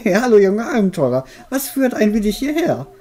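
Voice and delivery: put-on high, cackling old witch. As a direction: play the friendly old witch. Voices the witch character.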